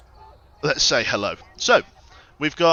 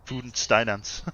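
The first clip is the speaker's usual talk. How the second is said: mock German accent. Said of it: "I apologise to any Germans in".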